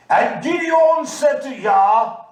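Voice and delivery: slow, loud and high, preaching at full force; preaching shout. Acted. Preacher shouting the story of Gideon to the congregation.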